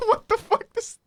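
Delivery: high laughing through words; can barely talk for laughing; laughing hysterically. Real, not acted. Hysterical laughter at blue people joke.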